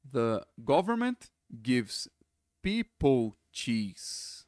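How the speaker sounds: slow, careful read of the sentence; slow it down so students can follow; slow demonstration. Shown as a demonstration. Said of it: "prestar atenção".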